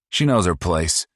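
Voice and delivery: high, loud, smug put-down; smug cruel boast; sneering son. Acted. Paul voiced, sneering.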